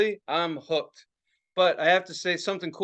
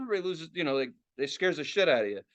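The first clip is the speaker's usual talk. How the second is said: ordinary delivery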